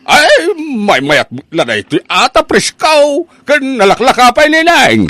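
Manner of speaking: slow, high, loud persuading; eager sales pitch. Acted. Vendor pressing the young woman to buy, loud and eager. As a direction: lean in and sell it hard. Vendor pushing his goods.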